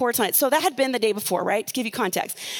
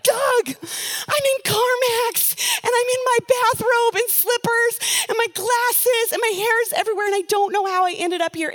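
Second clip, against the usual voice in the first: retold bawling; 10 semitones higher than usual. Wife calls Doug from CarMax, higher crying plea. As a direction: imitate sobbing down the phone. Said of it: "And I was bawling".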